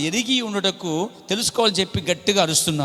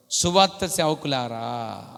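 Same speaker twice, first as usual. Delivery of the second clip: drawn-out, measured address to the servants; slow solemn call; 4 semitones lower, slower than usual. Retold or imitated. Prophet slowly addresses gospel servants, solemn and drawn out. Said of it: "ఇప్పుడు అన్నాడు ప్రవక్త".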